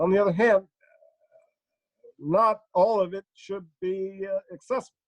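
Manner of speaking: halting, both-sides presidential oratory; Barack Obama. Acted. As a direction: measure out each phrase, stuttering between them. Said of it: "if you ask him his opinions on guns".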